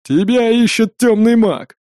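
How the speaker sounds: slow loud growl, stress on тёмный; growling dog voice. A narrator's reading. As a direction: growl out тёмный, drawn out and heavy. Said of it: "прорычала она с ехидной усмешкой". (рычит, выделяя «тёмный»)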